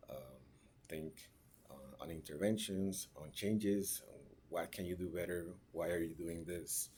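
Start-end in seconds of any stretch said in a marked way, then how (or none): none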